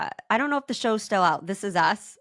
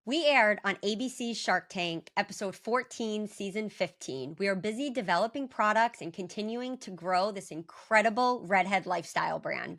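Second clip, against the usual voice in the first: calm scripted sponsor read; 5 dB quieter than usual. Acted. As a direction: read the brand pitch smoothly. Co-host continues sponsor read.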